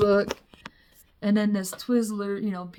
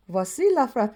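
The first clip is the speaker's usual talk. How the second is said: French waiter; 3 semitones higher, 3 dB louder than usual. Acted. French waiter serving a fart.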